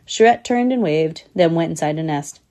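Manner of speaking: plain reading voice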